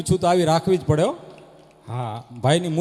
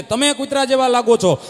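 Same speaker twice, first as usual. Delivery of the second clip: higher, louder quarrel shout; quarrel yell; 5 semitones higher, 7 dB louder than usual. Retold or imitated. Husband yelling insult at wife, loud quarrel. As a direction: hurl the insult back.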